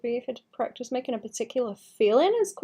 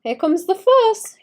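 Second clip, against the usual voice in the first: sing-song; 10 semitones higher, 5 dB louder than usual. Pretend. Playful sing-song announcement.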